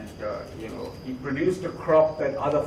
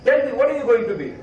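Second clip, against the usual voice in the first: higher, insistent questioning; pressing father; 7 semitones higher than usual. Acted. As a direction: demand an answer from the silent son. Father pressing silent son.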